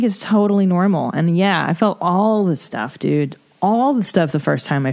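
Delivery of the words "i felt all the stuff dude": usual speaking voice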